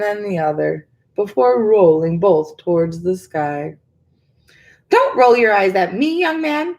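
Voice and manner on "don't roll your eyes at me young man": high, louder indignant huff; huffing old woman. A narrator's reading. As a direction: scold him like a cheeky boy. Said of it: "the old woman huffed".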